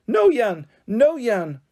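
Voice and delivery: put-on high, loud toddler shout; a baby yelling out, pointing. A narrator's reading. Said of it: "Holly decided to shout out".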